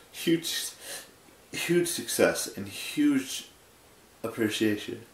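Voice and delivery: talking through a yawn. Real, not acted. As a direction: stretch the words out as a real yawn takes over. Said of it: "for yawning".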